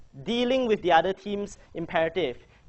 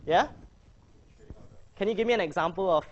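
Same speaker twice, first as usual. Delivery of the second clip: ordinary talk, nothing marked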